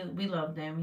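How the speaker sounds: normal talking voice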